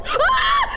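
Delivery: sudden high yelp of fright; a real shriek at the jump scare; startled scream. Real, not acted. Jump scare in horror game.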